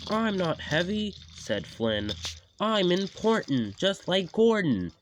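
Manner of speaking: high loud boast; proud boast. Acted. Flynn boasting to James, proud. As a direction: puff up with pride.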